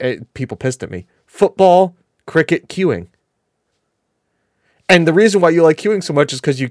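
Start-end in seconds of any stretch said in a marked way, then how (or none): 1.2-1.9 FOOTBALL said loud and higher; "Football" announced high and loud; deliberately exaggerated pronunciation. Shown as a demonstration. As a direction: push "Football" up and out loudly. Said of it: "I have to do it this way".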